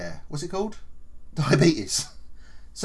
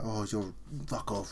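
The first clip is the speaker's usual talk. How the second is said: muttered curse under the breath, softer; 5 semitones lower, 12 dB quieter than usual. Retold or imitated. Worker cursing his manager under his breath, softer. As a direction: grumble it to yourself so the manager can't hear. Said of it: "under my breath and in my head".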